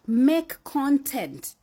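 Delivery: slow, drawn-out command; slow and emphatic; slow emphatic advice. Real, not acted. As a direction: spell it out slowly.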